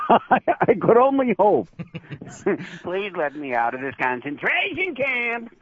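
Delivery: put-on high, goofy young Jerry Lewis voice; hammed-up high plea with goofy laughs; Kerwin. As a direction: whoop it up as a comic puppet. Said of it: "are we gonna see Kerwin".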